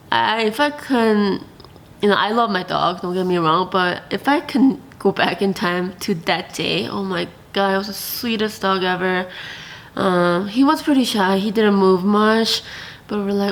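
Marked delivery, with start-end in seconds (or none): none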